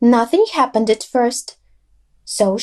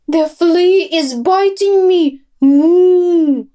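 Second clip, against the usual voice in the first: raised, panicky cow shout; 5 semitones higher, 4 dB louder, slower than usual. A narrator's reading. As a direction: cry out as the flea bites. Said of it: "shouted Marosa in panic".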